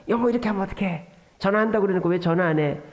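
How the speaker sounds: ordinary talk, nothing marked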